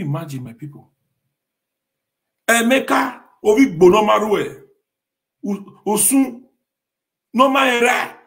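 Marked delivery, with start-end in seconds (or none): 2.5-8.2 names hurled out loud and high; loud calling out. Real, not acted. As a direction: call them out one by one, loud.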